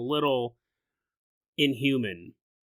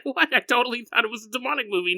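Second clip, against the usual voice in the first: mock surprise; 9 semitones higher, faster than usual. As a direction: blurt it out as a baffled viewer. Voicing a surprised, mistaken listener.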